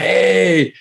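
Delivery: put-on high delighted hey at the British accent; mock surprised hey. Clerks react to the British accent, high delighted hey. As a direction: squeal a pleased little hey. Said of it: "they were just like".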